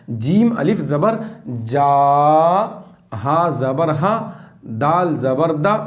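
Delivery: slow, syllable by syllable spelling; slow letter-by-letter spelling; spelled out slowly. Shown as a demonstration. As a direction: spell each piece apart, slowly. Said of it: "एक साथ नहीं पढ़ना है तीनों को".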